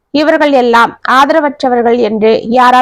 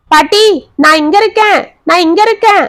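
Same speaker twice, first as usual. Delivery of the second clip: put-on higher, playful children's shout; children calling out; 6 semitones higher than usual. A narrator's reading. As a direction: call out teasingly, like kids in a game. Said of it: "என்று கத்திக்கொண்டே". (பாட்டியை விளையாட்டாகக் கூப்பிடும் குழந்தைகளின் உற்சாகக் குரல்)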